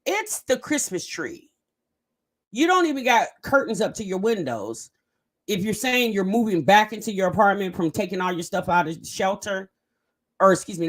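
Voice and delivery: normal talking voice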